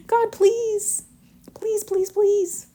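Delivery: high-pitched mock begging; pleading prayer. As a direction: beg God in a high, drawn-out whine. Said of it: "'cause she's like, "God, please".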